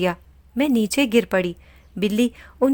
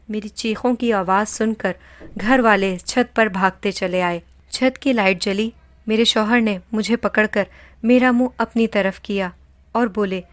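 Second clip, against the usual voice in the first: usual speaking voice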